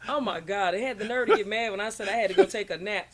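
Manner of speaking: loud, amused outburst through laughter; real laughing disbelief; laughing exclamation. Real, not acted. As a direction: exclaim it loudly while cracking up. Laughing over friend's antics.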